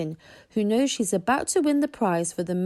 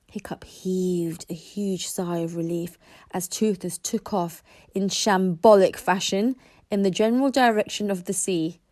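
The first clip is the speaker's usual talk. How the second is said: ordinary delivery, nothing marked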